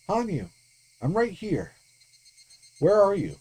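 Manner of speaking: high, urgent calls into the dark; calling out. Acted. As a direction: call for her, anxious and pleading. Rika calling out again.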